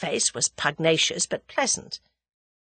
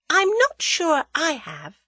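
high, slow, meaningful reply; darkly; 11 semitones higher, slower than usual. A narrator's reading. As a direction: draw it out with dark meaning. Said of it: "said Tuppence darkly".

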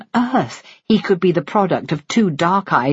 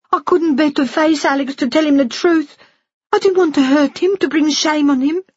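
put-on higher, tearful older woman pleading; Missus Shinwell voice; 12 semitones higher than usual. Woman confessing to the nurse, high and tearful. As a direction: voice her high and aching with guilt.

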